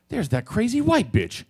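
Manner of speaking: imitated bystander. Girl in club line spots the woman, imitated call-out. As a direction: lightly mimic a girl calling it out.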